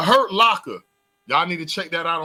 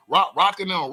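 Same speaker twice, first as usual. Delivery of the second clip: ordinary talk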